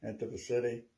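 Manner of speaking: ordinary talk, nothing marked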